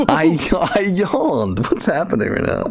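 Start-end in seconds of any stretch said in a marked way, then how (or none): 0.1-1.6 slow, high, laughing through words. Real, not acted. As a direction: protest while cracking up. Laughing protest.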